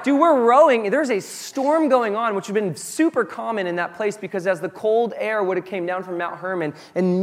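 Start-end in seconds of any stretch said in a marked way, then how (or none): 0.0-2.3 loud, high panicked protest; freaking out. Retold or imitated. Disciples to sleeping Jesus in a storm, freaking out loudly. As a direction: act out a rower yelling over the storm. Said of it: "they're freaking out".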